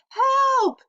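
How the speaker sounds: high loud yell for help. A narrator's reading. Boy shrieking for help from his chasing brother. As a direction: scream it in panic. Fudge crying out.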